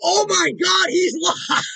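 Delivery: high, loud laughing shout of disbelief; cracking up and shouting at the news; shocked laughing shout. Real, not acted. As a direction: yell it out through laughter. Discovers rival is live.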